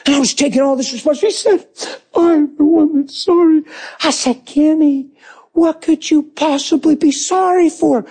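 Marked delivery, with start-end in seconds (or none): 2.2-3.6 slow, high tearful apology; weepy wife taking the blame; imitated crying. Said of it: "She's bawling". 4.5-8.0 tearful question. Retold or imitated. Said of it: "I'm bawling".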